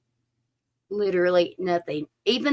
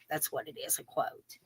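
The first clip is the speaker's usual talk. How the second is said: fades into a whisper; soft realization, almost unvoiced; trailing whisper; 3 semitones lower, 15 dB quieter than usual. Real, not acted.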